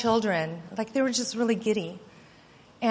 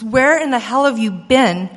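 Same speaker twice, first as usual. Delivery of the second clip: angry mother; 4 semitones higher, 9 dB louder than usual. Retold or imitated. Mother yelling at lost child.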